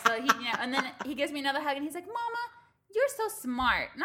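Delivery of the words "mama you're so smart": put-on toddler voice. Mom voicing her toddler son.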